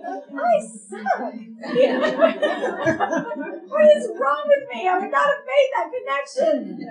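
high, loud laughing wail; mock despair. Pastor outdone by friend's boldness, mock wail. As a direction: wail it in comic self-reproach. Acted comic self-reproach.

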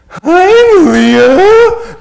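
very high, loud silly yell of her name; obnoxious loud greeting. Retold or imitated. Dad embarrassing daughter at the mall, loud high shout. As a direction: embarrass your teen daughter at full volume. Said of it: "I would start going like this".

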